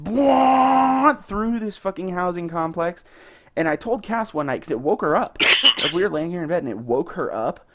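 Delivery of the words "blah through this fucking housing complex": shouted, dragged out, much higher pitch; loud truck-noise rant. Retold or imitated. Griping about the truck that wakes his wife, shouting. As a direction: belt it out like the truck is blasting past. Neighbour's truck roaring past nightly.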